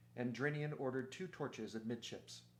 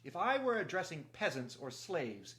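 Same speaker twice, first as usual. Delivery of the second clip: higher, louder challenge; clear-voiced Reepicheep; 5 semitones higher, 5 dB louder than usual. A narrator's reading. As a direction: break in with a clear, raised voice. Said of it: "the clear voice of Reepicheep".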